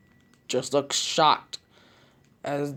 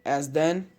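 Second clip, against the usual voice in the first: usual speaking voice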